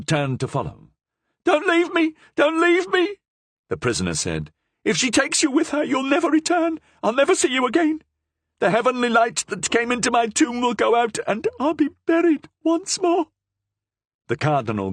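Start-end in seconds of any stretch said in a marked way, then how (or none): 1.4-13.3 very high, desperate pleas; panicked begging. A narrator's reading. Prisoner begging the Cardinal to stay, high and panicked. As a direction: beg him not to go.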